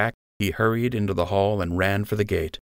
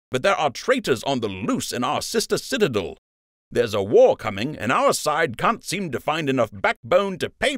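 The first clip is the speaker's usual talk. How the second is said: angry officer; 6 semitones higher than usual. A narrator's reading. Lieutenant berating Joe, angry lecture. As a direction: dress him down hard.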